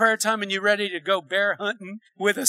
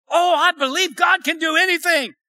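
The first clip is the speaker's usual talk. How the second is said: higher-pitched mock declaration of faith; confident believer; 8 semitones higher, 4 dB louder than usual. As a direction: boldly voice a sure believer. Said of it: "some people believe God".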